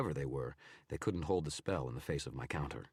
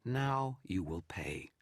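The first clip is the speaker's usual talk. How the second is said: slow, drawn-out sneering threat; 5 semitones higher, much slower than usual. Acted. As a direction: savour the threat, slow and cruel. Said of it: "a sneer to its voice".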